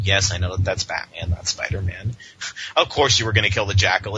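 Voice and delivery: normal talking voice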